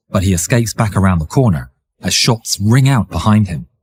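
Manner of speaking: ordinary delivery, nothing marked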